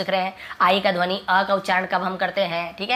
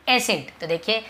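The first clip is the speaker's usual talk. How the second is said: ordinary talk, nothing marked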